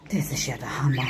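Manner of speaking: breathy hushed wow; whispered amazement. Real, not acted. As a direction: whisper it in awe. Amazed aside.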